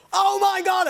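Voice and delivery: high, loud burst of surprise; stunned cry at the last page; shocked exclamation. Retold or imitated. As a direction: gasp it out in shock. Retold reaction to reveal.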